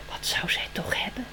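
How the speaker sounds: hushed, breathless whisper. A narrator's reading. Spring wonders in a whisper. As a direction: whisper it like a secret thought.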